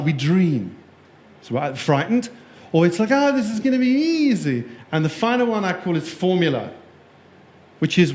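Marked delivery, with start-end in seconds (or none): 3.1-4.6 higher, lilting mock confidence; mock breezy optimism; breezy overconfidence. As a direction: play someone sure it's easy. Acting out an overconfident attitude.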